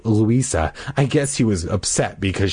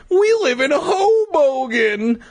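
very high, slow squeal; exaggerated squeaky kid, stretched out; child hero voice; slower than usual. Hero explaining home to Sam, squealing kid voice.

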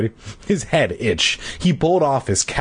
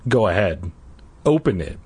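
plain reading voice

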